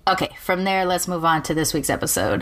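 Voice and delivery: ordinary talk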